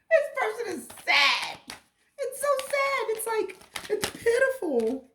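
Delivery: real emotion, voice cracking up high with a laugh; crying-laughing through the words; tearful break. Real, not acted. Reader overcome by the sad card, voice breaking. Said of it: "Choking up a lot during this".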